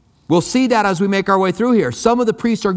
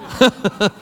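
short laugh; 3 semitones higher than usual. Real, not acted. Laughs at own answer.

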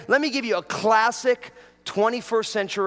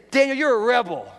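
higher, emphatic accusation; with more conviction; 6 semitones higher, 4 dB louder than usual. Shown as a demonstration. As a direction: call it out as if convinced. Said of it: "You guys don't believe it at all".

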